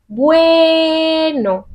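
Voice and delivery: Bueno alto y alargado en la E; stressed demo word. Shown as a demonstration. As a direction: stretch Bueno, weight on the E. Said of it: "Es la E la que tiene la fuerza". (Bueno alargado, fuerza en la E)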